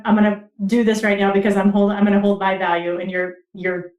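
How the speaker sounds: normal talking voice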